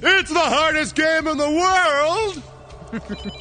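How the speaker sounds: slow, booming announcer catchphrase; drawn-out game show announcer. Acted.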